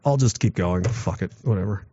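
low, softening inner voice; retold resigned indifference. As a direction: shrug it off under your breath. Acts out giving-in thought while struggling.

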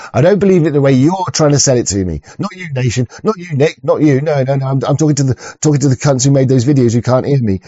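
ordinary talk

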